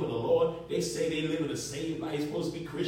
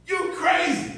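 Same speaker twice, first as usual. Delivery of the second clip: mock accusation; 11 semitones higher, 6 dB louder, slower than usual. Mimicking people calling him crazy.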